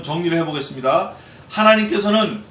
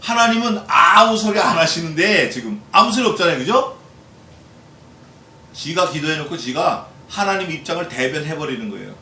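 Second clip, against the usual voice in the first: normal talking voice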